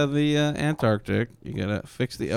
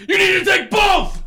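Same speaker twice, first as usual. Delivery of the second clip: high, loud pretend shout; mock frantic yell; 6 dB louder than usual. Man running up to nerd kids, frantic yelling. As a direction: yell it like urgent life advice. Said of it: "run up to them like".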